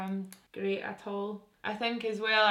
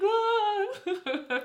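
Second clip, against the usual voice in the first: laughing squeal; 6 dB louder than usual. Retold or imitated. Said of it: "I'm like".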